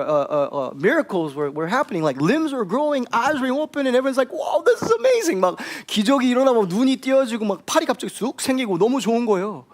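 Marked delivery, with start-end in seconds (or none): 4.3-5.4 high, thrilled gasp of wonder; amazed. Retold or imitated. Crowd at the healing service, amazed. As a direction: sound dazzled by the miracles.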